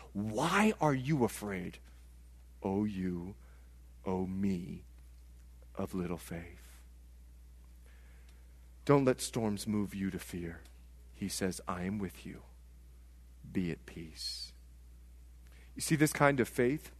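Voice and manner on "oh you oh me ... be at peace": hushed, tender pastoral tone; gentle reassurance. Real, not acted. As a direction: lower the voice to calm the fearful.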